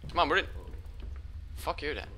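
ordinary delivery

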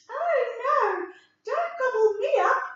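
high, small billy goat voice; a tiny nervous goat; little goat voice. Acted. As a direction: squeak it out like the tiniest goat.

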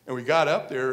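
ordinary delivery, nothing marked

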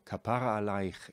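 "Kapara" said ka-PA-ra, middle syllable stressed; stress on second syllable. Shown as a demonstration. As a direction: put the weight on "pa" in "Kapara". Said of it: "put the emphasis on the second syllable".

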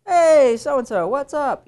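high, loud mock-chummy call; overfamiliar greeting. Lecturer mimics a too-casual emailer, overfamiliar and high. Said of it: "That familiarity doesn't make sense".

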